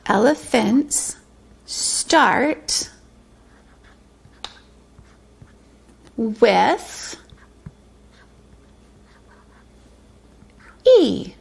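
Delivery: drawn out, long pauses between words; dictating slowly while printing. Shown as a demonstration. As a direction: say each word very slowly while writing. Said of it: "writing it on the board".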